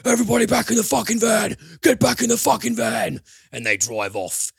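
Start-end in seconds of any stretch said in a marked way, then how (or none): 0.0-3.2 frantic high shout, fast and much louder; panicked yell. Retold or imitated. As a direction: scream the crew back into the van in a panic. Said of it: "he goes to the rest of them".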